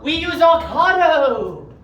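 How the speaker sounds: loud, slow indignant cry; indignant outburst. Acted. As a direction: blurt it out proudly. Villain blurts indignantly.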